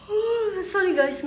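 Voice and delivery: sudden startled apology; surprised. Real, not acted. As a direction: jolt awake at your own mistake. Catches own mistake.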